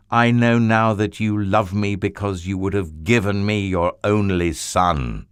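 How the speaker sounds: slow, loud and resonant; voice of God. Acted. God speaks to Abraham, slow and loud with authority. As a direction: speak with grave, loving authority. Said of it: "God said".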